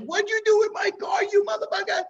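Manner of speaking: high shrieking angry mother; imitating an enraged mother screaming; mother yelling. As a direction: screech it like a furious mom. Mother beating him, yelling.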